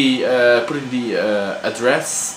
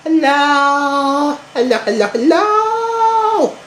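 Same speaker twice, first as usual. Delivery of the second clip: high, sing-song hellos; teaching a parrot; 13 semitones higher, much slower than usual. Shown as a demonstration. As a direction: coax the pet to say hello. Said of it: "Say hello".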